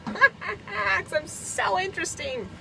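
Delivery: mock self-praise, drawn out with a laugh; sarcastic. Real, not acted. Self-mocking joke about herself.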